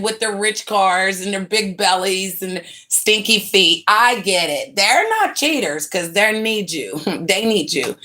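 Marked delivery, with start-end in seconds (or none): none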